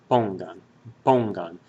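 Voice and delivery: "Pongan" with the weight on PON; stress on first syllable. Shown as a demonstration. Teacher sounding out the word for learners, first syllable stressed. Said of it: "hear that the stress in that word".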